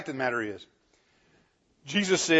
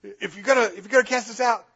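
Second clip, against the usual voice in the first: higher, wheedling demon voice; bargaining demons; 8 semitones higher, 3 dB louder, faster than usual. Acted. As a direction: try to strike a deal in a pitched-up demon voice.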